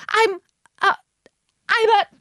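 high, halting stammer; flustered. A narrator's reading. Alice trying to say what she is, flustered. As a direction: falter between words in a high voice. Alice falters under questioning.

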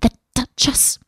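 fretful mutter to itself; anxious muttering. A narrator's reading. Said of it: "heard it muttering to itself".

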